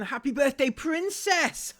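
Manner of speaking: high, loud mock-adoring read; gushing fan. Reading Tracy's comment aloud in a mock-doting voice. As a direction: swoon over "princess" mockingly.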